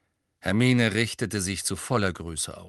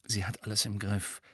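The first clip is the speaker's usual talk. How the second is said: murmured; 7 dB quieter than usual. A narrator's reading. Ron to Harry from his armchair, murmured. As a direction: mutter it quietly under your breath. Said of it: "Dann murmelte er Harry zu".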